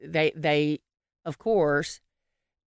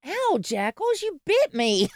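high startled yelp at the dog; 10 semitones higher than usual. Real, not acted. Bitten by her dog mid-podcast, high startled yelp. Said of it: "dog bites speaker".

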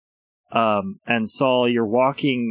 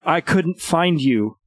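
shaken and breathless; 5 semitones higher than usual. Acted. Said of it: "she's gasping for air".

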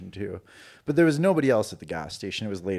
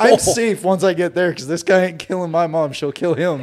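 laughing quote; 7 semitones higher, 9 dB louder than usual. Retold or imitated. Laughing about mom's power.

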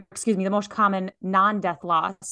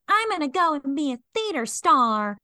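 dreamy theater star; 7 semitones higher than usual. Retold or imitated. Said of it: "So I was like".

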